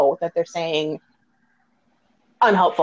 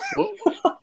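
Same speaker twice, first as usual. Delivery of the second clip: laugh; 12 semitones higher, 6 dB quieter than usual. Real, not acted. Laughing at friend's crude drawings.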